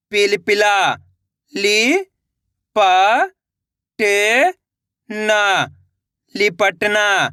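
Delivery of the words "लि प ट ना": "लि-प-ट-ना" very slowly, syllables apart; sounding out slowly. Shown as a demonstration. Teacher showing children how to sound out a word. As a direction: sound out "लि-प-ट-ना" piece by piece. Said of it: "पहले लिपट पढ़ो, उसके बाद ना पढ़ो".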